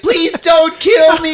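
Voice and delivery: very high, loud, slow wail; hammed-up victim begging for life; mock terrified plea. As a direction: squeal it out in mock terror.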